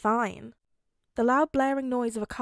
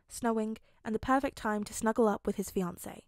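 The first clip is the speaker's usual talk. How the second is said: usual speaking voice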